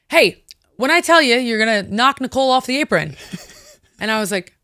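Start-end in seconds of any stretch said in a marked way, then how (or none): 0.1-3.1 loud, high, clearly called instruction; John Cena. Retold or imitated. Wrestler calls a move to her from a headlock, loudly. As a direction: call the move out openly across the ring. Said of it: "clear as day".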